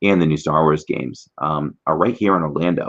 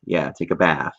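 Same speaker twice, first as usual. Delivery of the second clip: ordinary talk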